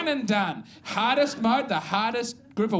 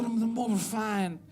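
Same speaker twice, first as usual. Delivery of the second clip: "more refined" said softly and preciously, in a snooty put-on voice; mock snob; 3 dB quieter than usual. A coffee snob savouring the words "more refined", precious and soft. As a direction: lean into "more refined" like a connoisseur. Snob echo of earlier line.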